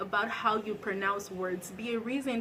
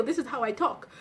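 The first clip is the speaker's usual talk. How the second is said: ordinary talk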